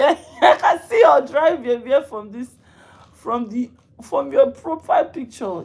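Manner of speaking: mock them while cracking up; giggling insult; laughing roast. Real, not acted.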